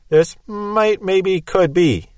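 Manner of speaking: slow, hesitant mock hedging; wishy-washy. Preacher parodying a muddled statement, wishy-washy. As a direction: back off into feeble maybes. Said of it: "that's wishy-washy in the extreme".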